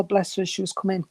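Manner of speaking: ordinary delivery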